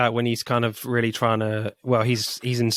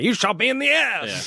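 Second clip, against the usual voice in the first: high indignant squeal of a shot man; comic pained outrage; mock outraged yell; 11 semitones higher than usual. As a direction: squeal it like you just got shot in the butt. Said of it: "He's like".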